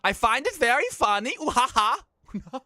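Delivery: high, hammed-up Swedish accent with a slow fake laugh; cringe accent. Streamer indulges the chat with his cringe Swedish accent. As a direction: ham up the silly Swedish accent. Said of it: "can you tell it with that cringe accent".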